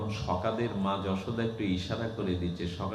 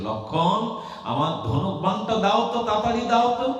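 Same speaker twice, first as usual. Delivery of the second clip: jolted awake, much higher and louder, calling Lakshman; startled urgent call; 13 semitones higher, 7 dB louder than usual. Acted. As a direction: yell for the bow in a rush, rising. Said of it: "ধড়ফড় করে উঠে বলছে". (চমকে উঠে জোরে উঁচু স্বরে ডাক)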